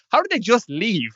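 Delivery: high-pitched retold disbelief; incredulous, pitched well up. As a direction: question it in mock astonishment. Retelling disbelief at friends' breakups.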